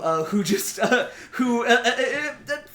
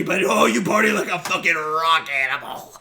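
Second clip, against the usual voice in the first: normal talking voice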